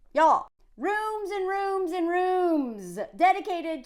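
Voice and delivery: slow, lingering stress on repeated "rooms"; stretched emphasis. Real, not acted. Stresses how many rooms there are.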